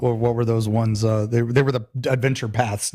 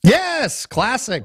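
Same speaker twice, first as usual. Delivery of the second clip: excited; 8 semitones higher, much slower than usual. Real, not acted. Excited agreement about favourite show.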